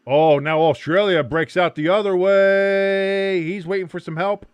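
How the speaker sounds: excited match commentator; live play-by-play. Real, not acted. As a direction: call the action, stretching the big moments.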